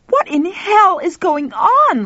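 drawn-out, high, loud demand; outraged high-pitched yell; screech into roar. A narrator's reading. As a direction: screech it out slowly, rising to a roar. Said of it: "starting as a screech and ending as a roar".

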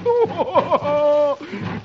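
high, wavering mock sobs; boo-hoo in hammed-up misery; comic sobbing wail. Dagwood lamenting wasted money, high sobbing wail.

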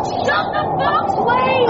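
high, drawn-out order over wind; panicked high call; urgent shout. Acted. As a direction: yell it through a gale.

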